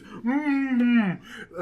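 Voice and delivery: high muffled hum through a taped mouth; muffled mumble. Retold or imitated. Dan mouth duct-taped, trying to apologize, muffled. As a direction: imitate trying to talk with lips sealed. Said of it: "assuming, like, "I'm sorry".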